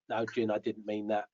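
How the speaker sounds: quiet aside. Real, not acted. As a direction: drop the voice so he can't hear. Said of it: "'cause I spoke quietly".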